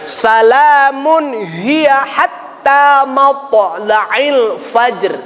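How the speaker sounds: slow chanted reading of the full verse; model recitation. Shown as a demonstration. Teacher gives the final model to imitate, slow chant. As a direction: recite slowly and melodically.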